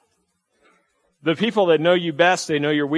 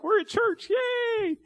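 mock-happy, much higher squeal; fake cheerful; much slower than usual. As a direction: sing it out like a fake church smile. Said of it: "put on a happy face and be like".

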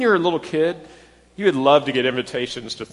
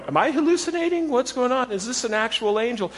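much higher, bewildered questions; startled woman; 8 semitones higher than usual. Retold or imitated. Pastor voicing a bewildered woman at the tomb, high and startled. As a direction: play the women unsure of their eyes. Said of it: "and they're going".

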